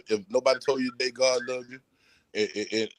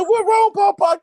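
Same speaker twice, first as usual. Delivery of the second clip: put-on much higher, little-girl voice asking innocently; imitating granddaughter; 6 dB louder than usual. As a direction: mimic the grandbaby's high, innocent question. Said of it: "She said".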